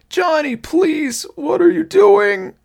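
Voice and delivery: very high, loud, slow wail; pleading. A narrator's reading. Thing on the ground begs Johnny, pleading. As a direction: wail the plea in a put-on high voice. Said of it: "the thing on the ground pleaded".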